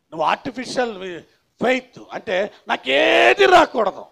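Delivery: loud, high, drawn-out mimicry; mock believer's demand. Preacher imitates someone with artificial faith, in a loud mock demand. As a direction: stretch it out as a mocking protest.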